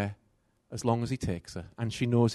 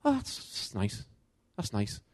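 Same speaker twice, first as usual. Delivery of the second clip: soft, slow, unimpressed murmur; a quiet, indifferent that's nice; softly voicing his mother's indifference; mum's flat reply; 4 semitones lower, 8 dB quieter than usual. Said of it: "And she'll go".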